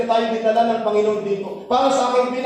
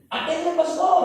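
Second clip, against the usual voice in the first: imitated old man; 9 semitones higher than usual. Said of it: "Sabi niya".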